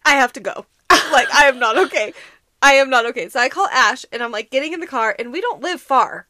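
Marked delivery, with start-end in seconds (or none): 0.0-3.3 louder, pressing I have to go; urgent retold plea. Sick coworker at work insisting she has to leave. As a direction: insist you must leave now, half laughing. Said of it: "I was like".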